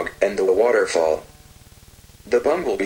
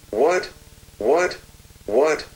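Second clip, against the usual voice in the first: higher, rising echo of a question; echoed surprise; 5 semitones higher, slower than usual. A narrator's reading. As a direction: parrot the surprised question, lifting each time. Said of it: "repeated after Sylvie".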